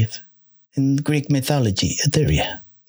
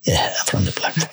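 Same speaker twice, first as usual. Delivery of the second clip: spooky whisper; 9 semitones lower than usual. Pretend. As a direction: whisper it like a campfire ghost story.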